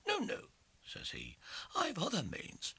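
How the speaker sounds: very high sly retort; sneering lawyer. Acted. Lawyer refusing to fight, high and sly. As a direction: sneer it smugly.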